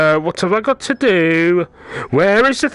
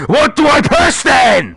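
a high, loud, fed-up yell of the question; exasperated shout; 9 semitones higher, 6 dB louder than usual. Real, not acted. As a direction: shout it out at the screen. Repeated question, now yelling.

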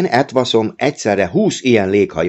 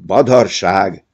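a touch of angry scorn, a bit louder; 4 dB louder, slower than usual. A narrator's reading. Uncle scoffs at the boy's drawing, mildly angry. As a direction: dismiss it crossly. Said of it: "haragudott meg a bácsi".

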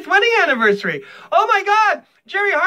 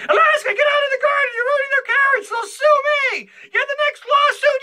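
much higher, fast frantic shout; yelling at the dog; 14 semitones higher than usual. Retold or imitated. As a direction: shriek at the dog in the garden. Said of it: "I'm always saying".

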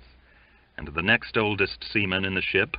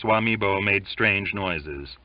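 ordinary delivery

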